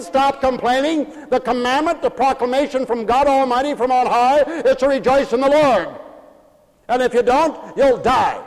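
full-force preaching. Real, not acted. Preacher forbidding the congregation to complain, full-force preaching. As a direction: ring out each command, drawing out the key words.

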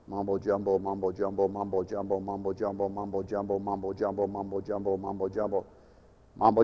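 low sleepy monotone drone. Shown as a demonstration. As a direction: drone it on one flat note, very quiet. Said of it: "carry on in the monotone".

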